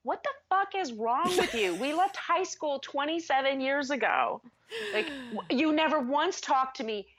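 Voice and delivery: high, indignant retold rant; raging. Woman recalling telling off an old classmate, raging. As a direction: rail at him in exasperated disbelief. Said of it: "I'm raging all over the place here".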